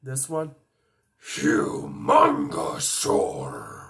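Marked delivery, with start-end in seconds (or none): 1.2-3.8 very low, slow booming roar; monster voice. Acted. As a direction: stretch it out deep and huge. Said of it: "some Ben Ten alien voices".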